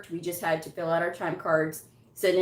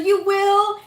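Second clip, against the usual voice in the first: much higher and louder, thrilled; excited; 14 semitones higher, 9 dB louder than usual. Retold or imitated. Recruiter thrilled the coder accepts, excited. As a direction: squeal it, thrilled. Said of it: "she was so excited".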